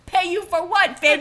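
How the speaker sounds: high, loud mock outrage; high indignant mock voice. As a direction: squeal it out indignantly. Acted outraged quote, far and high.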